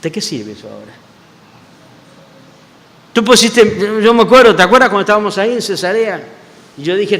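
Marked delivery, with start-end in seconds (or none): 0.0-0.9 soft, low, resigned; quiet weary question. Retold or imitated. Peter quietly asks the Lord what use it is now. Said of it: "imagino que Pedro le diría". 3.1-6.3 urgent plea. Retold or imitated. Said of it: "imagino que Pedro le diría".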